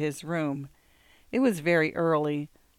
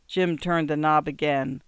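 ordinary delivery

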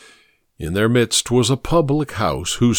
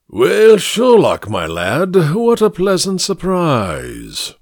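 booming, pompous high-flown greeting; grand, self-satisfied orator; pompous senator oratory; 7 semitones higher, 4 dB louder, slower than usual. Acted. Mycroft greets Sherlock grandly.

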